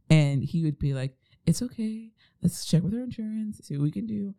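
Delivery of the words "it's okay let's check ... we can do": higher, calm and easygoing; imitating a calm husband; calm reassuring husband. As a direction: reassure her it's fine, lightly. Calm husband voiced in story.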